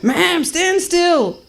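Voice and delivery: high, drawn-out exasperated order; security guard. Acted. Guard commanding traveller during scan, high exasperated order. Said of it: "this security guard".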